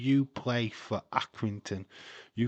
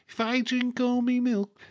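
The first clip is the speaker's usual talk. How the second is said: put-on Scouse kid voice, pitched up; Liverpudlian accent; 14 semitones higher, 6 dB louder than usual. Quoting the old milk advert kid in a Liverpudlian accent. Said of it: "a Liverpudlian accent".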